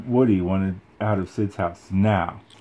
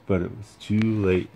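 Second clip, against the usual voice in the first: plain reading voice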